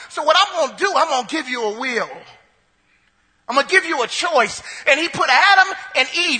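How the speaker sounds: rousing sermon delivery; full-force preaching. Acted. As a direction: preach it out to the church.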